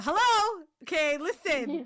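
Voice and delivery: high, loud hello to regain the room; playful call for attention. Real, not acted. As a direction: snap everyone back to attention. Calling everyone to order, laughing.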